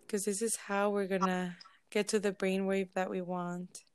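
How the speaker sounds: unhurried, measured relaxation voice with lingering silences; guided meditation. Real, not acted. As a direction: ease listeners into stillness, phrase by phrase. Leads a group meditation.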